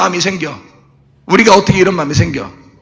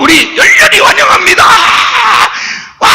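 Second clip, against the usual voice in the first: much higher, loud ecstatic welcome; fervent welcome; 12 semitones higher, 3 dB louder than usual. Shown as a demonstration. Preacher acting out welcoming God as king, ecstatic shout. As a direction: shout it out with all your heart. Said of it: "진짜 하나님을 대통령으로 열렬히".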